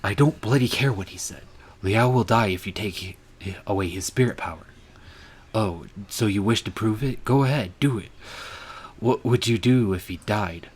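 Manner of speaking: loud, heated reprimand; angry scolding. Acted. Scolding the leopard for trusting Yanshu Chi, loud and angry. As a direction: tear into him for believing the lie.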